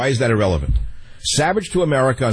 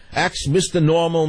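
ordinary delivery

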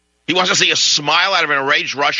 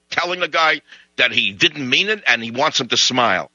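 ordinary talk, nothing marked

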